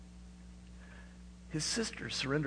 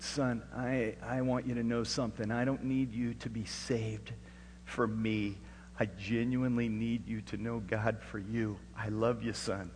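heartfelt sincerity; 2 semitones lower, 3 dB quieter than usual. Retold or imitated. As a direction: speak gently and earnestly to your son. Retold heart-to-heart with his son.

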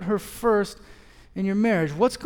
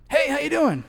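bright, much higher hello; chirpy dad plopping down beside you; cheery louder greeting; friendly stranger greeting; 10 semitones higher, 4 dB louder than usual. Retold or imitated. Dad greets him on bench.

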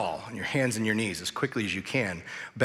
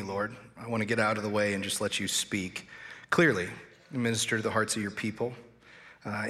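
softer, hushed pastoral prayer voice; earnest prayer; 3 semitones lower, 4 dB quieter than usual. Real, not acted. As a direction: pray quietly and humbly for the congregation. Leads a prayer.

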